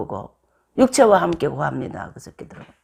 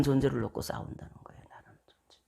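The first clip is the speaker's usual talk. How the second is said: whisper; 6 semitones lower, 14 dB quieter, faster than usual. Real, not acted. Preacher drops to whisper.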